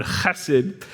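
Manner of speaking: chesed with a throat-clearing ch; guttural chesed. Shown as a demonstration. Pastor leading the congregation in saying it, guttural. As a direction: rasp the ch in chesed. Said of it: "that kinda deep, guttural sound".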